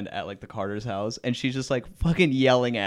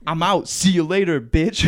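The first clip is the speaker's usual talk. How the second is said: mock angry shout; 6 semitones higher, 10 dB louder than usual. Boyfriend storming out.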